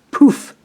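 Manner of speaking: high, loud burst of poof; sound-effect poof. A narrator's reading. Narrator pops the lightning strike with a high, loud poof. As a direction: pop it like a flash of lightning.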